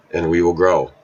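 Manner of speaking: ordinary talk, nothing marked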